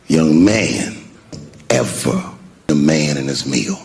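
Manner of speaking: put-on low, weighty voice of an older man; deep, measured pronouncement; imitated fatherly voice. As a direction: drop down into a stern elder's counsel. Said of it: "the way our father would look".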